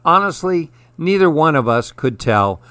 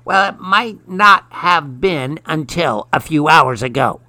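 lightly voiced storybook witch, pitched up; 4 semitones higher than usual. A narrator's reading. Witch claims the kitchen from a child, witch voice. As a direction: answer back as the witch, pitch raised a little. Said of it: "said the witch".

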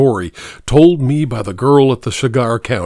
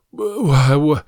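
stammering in terror, pitch higher; a frightened man facing a gun, stammering higher; 6 semitones higher than usual. A narrator's reading. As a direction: get the words out through fear, tripping on each start. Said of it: "I finally managed to stammer".

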